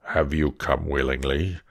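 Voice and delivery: low, looming beast voice; a monster asking gently. Acted. Beast asks Beauty if she came willingly, low and heavy.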